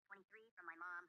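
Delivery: ordinary delivery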